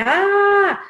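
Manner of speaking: put-on sharp high "ah" of a hurt boy; short yelp of pain; retold cry of pain. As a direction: cry out as if you just fell. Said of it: "Puis là, il fait".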